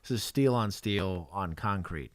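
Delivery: usual speaking voice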